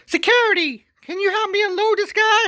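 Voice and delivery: very high, loud mock lady voice; falsetto woman. Wife asking security to unload the husband, in a high falsetto. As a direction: chirp it sweetly like nothing happened. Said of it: "goes to the gates".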